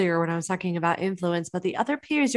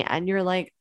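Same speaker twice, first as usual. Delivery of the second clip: ordinary talk, nothing marked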